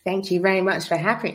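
usual speaking voice

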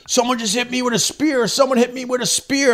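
high-pitched imitation of a panicking friend; mock panic. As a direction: act out a stoned friend's alarm. Retold stoned friend's panicked claim.

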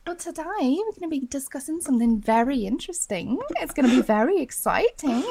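high-pitched mock Australian chatter; Kath and Kim Australian. Host continuing the Australian impression for Garrett, high mock Aussie. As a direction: play a chirpy Aussie presenter. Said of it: "Kath and Kim Australian accent".

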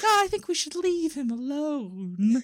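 high, sing-song pleading; hammed-up sweet little peacemaker; Happy Nick. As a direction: plead gently in a high cartoon voice. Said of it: "And then Happy Nick's like".

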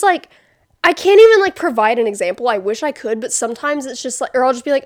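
ordinary talk, nothing marked

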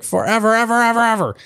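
mock childish pout. Cranky telling Pinkie Pie to go away, high whiny pout.

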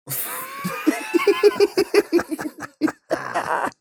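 cracks up into a shrieking laugh. Real, not acted. Cohost cracks up after the jingle, high laughing.

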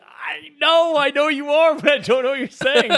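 high, strained mock desperation; flustered protest. Store worker answers the offended customer, flustered and pleading.

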